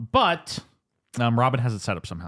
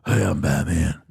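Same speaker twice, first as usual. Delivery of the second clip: a mild gravelly-free Batman try; lightly voiced bad Batman; wrong Batman voice; 6 semitones lower, slower than usual. Shown as a demonstration. Host demos the wrong way to do Batman. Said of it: "everyone does it wrong".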